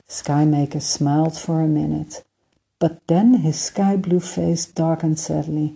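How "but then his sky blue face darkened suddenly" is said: ordinary delivery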